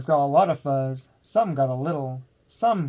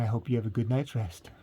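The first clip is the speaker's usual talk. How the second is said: soft goodnight; 4 semitones lower, 3 dB quieter, faster than usual. Real, not acted. Bedtime goodnight.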